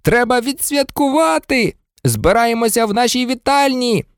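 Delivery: high, celebratory shout; rally everyone with a high call. A narrator's reading. George inviting Harry to celebrate, shouting. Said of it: "крикнув Джордж".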